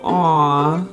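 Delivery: ordinary talk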